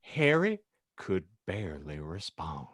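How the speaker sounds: ordinary talk, nothing marked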